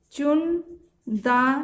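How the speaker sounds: चुनदानी spoken slowly, each syllable drawn out; slow dictation. Shown as a demonstration. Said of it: "चुनदानी आपको लिखना है". (चुनदानी को धीरे-धीरे बोलकर लिखवाएँ)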